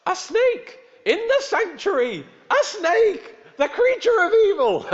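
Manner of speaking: high, loud shrieking alarm; shouted out. Retold or imitated. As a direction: cry it out in horror at a snake in church. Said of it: "and shouted out".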